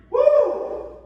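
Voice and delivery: high loud woo; effort whoop. Real, not acted. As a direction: whoop while straining. Cheer mid exercise.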